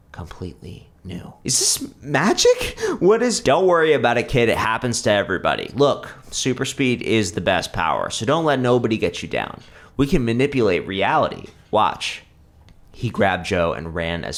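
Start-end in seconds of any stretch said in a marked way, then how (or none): 1.4-3.4 put-on boy amazed at his new legs; astonished boy. A narrator's reading. Joe amazed. 3.4-12.2 confident hero. A narrator's reading. Superhero reassures kid.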